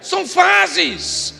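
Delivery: loud, slow, shouted sermon line; preaching shout. Acted. Preacher shouting at the congregation about money. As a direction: hammer it at the congregation.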